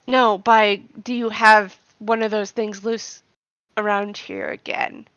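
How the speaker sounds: soft, puzzled character voice; quiet confused voice. Acted. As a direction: wonder it aloud, hushed. Vashi voiced by player.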